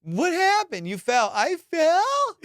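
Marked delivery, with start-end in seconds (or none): 0.0-0.9 high-pitched imitation of her asking; bewildered. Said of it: "With this tone". 1.3-2.4 very high, rising disbelief; incredulous. Retold or imitated. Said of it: "With this tone".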